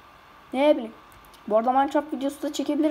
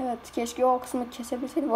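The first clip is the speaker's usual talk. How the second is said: usual speaking voice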